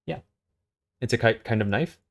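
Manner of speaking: steady story reading, level and even; story narration. Acted. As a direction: read the scene out to a friend. S0 reads a story aloud.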